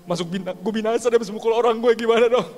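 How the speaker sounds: high, loud mock wail; imitated tearful panic. Crying friend tells pastor he will perish, mock panicked wail. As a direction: plead in exaggerated desperate panic. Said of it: "Dia nangis-nangis".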